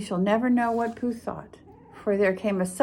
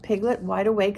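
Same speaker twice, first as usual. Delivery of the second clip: ordinary delivery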